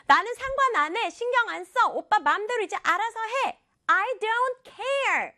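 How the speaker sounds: high-pitched, cutting brush-off; dismissive outburst. Acted. Sister cutting off her apologizing brother, high-pitched and dismissive. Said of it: "한마디로 탁 끊어버렸어요".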